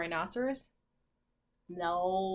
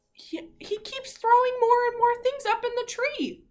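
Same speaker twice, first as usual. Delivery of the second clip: exasperated; 13 semitones higher, 5 dB louder than usual. Real, not acted. Exasperated about Floyd.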